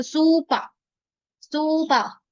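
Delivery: सूपा repeated with long vowels; teacher repeats सूपा slowly for the class; long vowel suupaa. Shown as a demonstration. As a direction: hold each vowel of सूपा. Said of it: "सही से pronunciation भी कर पाए".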